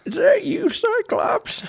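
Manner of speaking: put-on very high creaky old man. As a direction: quaver it like a grandpa. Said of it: "He's trying to make an old man voice".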